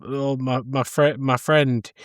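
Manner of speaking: ordinary talk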